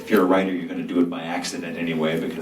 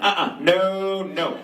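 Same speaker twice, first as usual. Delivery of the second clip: put-on sassy girl; 8 semitones higher than usual. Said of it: "the girl's like".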